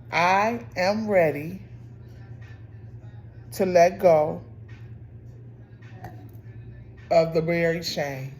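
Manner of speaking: slow, spaced-out affirmation, firm and deliberate; solemn card reading. Acted. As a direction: read it out as a declaration, pausing between phrases. Reads card affirmation out slowly.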